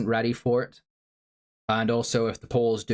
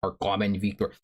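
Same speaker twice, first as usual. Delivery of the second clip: put-on Zelensky accent. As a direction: put on a deliberate Ukrainian president's accent. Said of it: "please do the Zelensky accent again".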